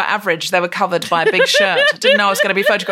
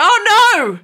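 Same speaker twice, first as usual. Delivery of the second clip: high, loud dramatic protest; dramatic wail; 7 semitones higher, 3 dB louder than usual. Retold or imitated. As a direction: wail it in mock despair. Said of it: "I just went".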